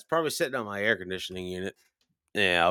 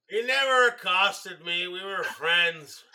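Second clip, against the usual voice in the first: high-pitched defensive objection, drawn out at the end; whiny-high, stretched-out denial; indignant protest; 9 semitones higher, slower than usual. Real, not acted. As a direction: protest the teasing accusation. Protests a teasing accusation from co-host.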